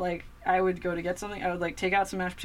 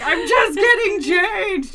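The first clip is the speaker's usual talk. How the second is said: high, loud, slow exasperation; exasperated; 13 semitones higher, 13 dB louder, slower than usual. Retold or imitated. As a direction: spell it out to a nagging cat. Exasperated answer to cat.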